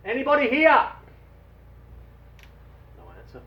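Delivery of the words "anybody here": called out loud and high; yell. Acted. Dave shouting to find anyone on the island. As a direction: call out across the island. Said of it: "He yells".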